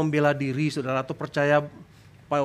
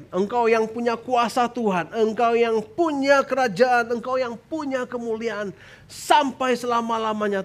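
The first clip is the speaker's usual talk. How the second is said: high, slow proclamation to God; victorious prayer; 6 semitones higher, slower than usual. Shown as a demonstration. Preacher models doa kemenangan, high triumphant praying. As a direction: declare it with drawn-out emphasis.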